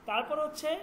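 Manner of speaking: ordinary talk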